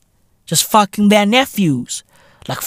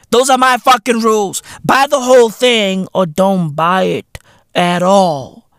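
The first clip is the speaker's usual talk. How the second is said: ordinary delivery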